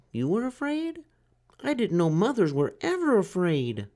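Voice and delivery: high, timid little turtle voice; small turtle Franklin. A narrator's reading. Franklin asks the duck for help, high and timid. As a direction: ask like a shy child. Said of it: "said Franklin".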